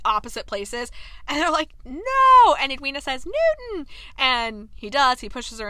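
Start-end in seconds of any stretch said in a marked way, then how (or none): 1.8-2.5 loud, high-pitched cry of no; acted yell across a distance; alarmed shout. Walkers calling across the park, alarmed shout. 3.2-3.9 high, drawn-out acted call; imitated alarmed cry to a dog. Edwina calling to runaway dog, calling out.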